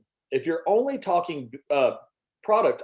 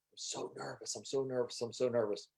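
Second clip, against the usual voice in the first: much softer anxious repeating; imitating nervous team; 11 dB quieter than usual. A backstage speaker before going on, softly panicking. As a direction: mutter it over and over, scared. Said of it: "they're just freaking out".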